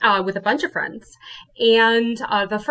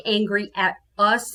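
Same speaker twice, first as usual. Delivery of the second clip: ordinary delivery, nothing marked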